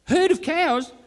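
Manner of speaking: high, loud outraged reply; indignant retort. Real, not acted. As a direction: snap back offended. Indignant mishearing.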